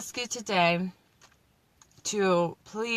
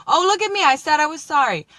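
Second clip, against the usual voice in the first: mock indignant; 8 semitones higher, 8 dB louder than usual. Playful mock indignation at herself.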